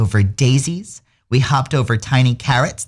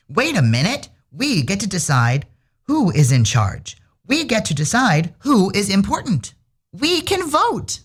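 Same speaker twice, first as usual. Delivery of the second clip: rising higher and more eager to a squeaky end; excited idea; 8 semitones higher than usual. A narrator's reading. Very good dog realizing the animals can vote, building to a squeal. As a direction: build up to a delighted high squeak.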